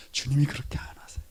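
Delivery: low soft whisper; whispered reassurance. Real, not acted. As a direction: whisper the gentle truth. Contrast with threatening god.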